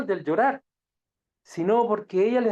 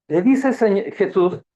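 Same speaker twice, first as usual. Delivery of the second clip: ordinary delivery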